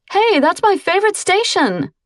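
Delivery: high, whiny complaint; complaining. Acted. Cousin complaining when her radio station is changed. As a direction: protest like a spoiled teen. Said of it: "Angela complained".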